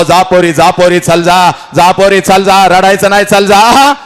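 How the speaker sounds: rising, insistent father's urging; impassioned farewell command; urgent send-off. Acted. Father urges daughter away, rising.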